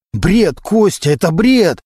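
heated disbelief; exasperated denial. A narrator's reading. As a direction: throw the accusation back, worked up. Cornered suspect rejects the accusation.

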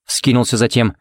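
ordinary delivery

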